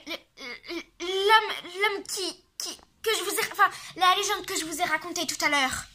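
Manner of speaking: high frightened stammer; panicked stammer. Acted. Godfather stammering in fear.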